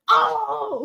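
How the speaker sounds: long, very high imitated "oh"; imitated outcry. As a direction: mimic a friend freaking out on a call. Friend's retold reaction on FaceTime.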